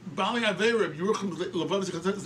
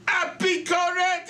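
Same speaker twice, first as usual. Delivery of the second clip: high, drawn-out accusing shout; outraged denunciation; 12 semitones higher, 6 dB louder, much slower than usual. Retold or imitated. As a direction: hurl it out, long and loud. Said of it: "jumps up".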